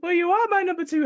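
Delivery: ordinary delivery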